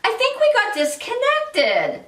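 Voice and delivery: high-pitched mock-innocent passive aggression; passive aggressive. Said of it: "That's passive aggressive".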